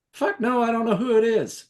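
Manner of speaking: high-pitched, emphatic refusal; irritated refusal. Acted. Anthony refuses to let the stranger in, high irritated voice. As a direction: call back a high, annoyed no.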